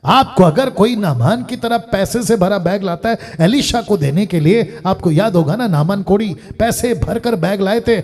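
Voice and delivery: loud, lively preaching with punched refusals. Real, not acted. Preacher refusing to be prophet for money, forceful preaching. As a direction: declare it forcefully to the congregation.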